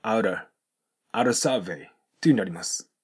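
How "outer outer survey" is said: "outer" with a soft flapped D. Shown as a demonstration. Teacher shows liaison, "outer survey" with soft D. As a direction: link out and a into "outer", tapping the T. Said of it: "ソフトDに変わる形で".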